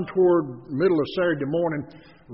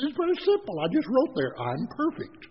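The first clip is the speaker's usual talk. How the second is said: higher, mock-innocent matter-of-fact tone; smug self-quote; 9 semitones higher than usual. Husband telling the seminar leader what he wrote, smugly. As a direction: play it cheekily self-satisfied. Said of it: "I said".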